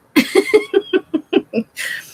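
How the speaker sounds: a burst of high-pitched giggling; high laugh. Real, not acted. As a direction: laugh up high at your own joke. Laughs at her own joke.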